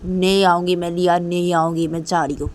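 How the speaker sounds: loud, defiant refusal; angry refusal. Acted. Rui telling Liya she won't come and is leaving, angry. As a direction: refuse loudly and storm off.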